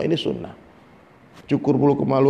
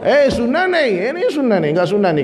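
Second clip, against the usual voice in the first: scolding elder sheikh; 6 semitones higher than usual. Retold or imitated. Elder sheikh berates the student over armpit hair, high mock scolding. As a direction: stretch it out high, then fall back down.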